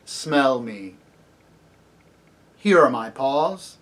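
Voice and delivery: slow, weighty commands with long pauses; commanding lion. A narrator's reading. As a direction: order it solemnly, word by word. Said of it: "spoke in a louder voice".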